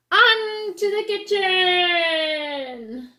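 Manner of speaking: slow, drawn-out gloating shout; triumphant cry. Acted. Fox gloating as he drives off, slow shout. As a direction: crow it out in triumph. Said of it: "and cried".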